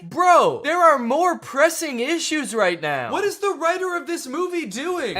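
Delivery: high-pitched, loud incredulous rant; worked-up comic exasperation; exasperated. Real, not acted. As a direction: protest as if you can't believe it.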